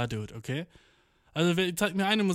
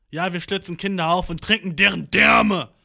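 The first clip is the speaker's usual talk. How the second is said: louder, scarier metal singer voice; mock metal growl; 4 semitones higher, 8 dB louder than usual. Shown as a demonstration. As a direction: sound as creepy as possible. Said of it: "so gruselig rüberkommen wie möglich".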